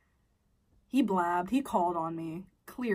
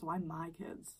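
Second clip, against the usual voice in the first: whispered; 2 semitones lower, 8 dB quieter, slower than usual. Real, not acted. Wondering aloud to viewers, whispered. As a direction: breathe the question out. Repeats question, second whispered.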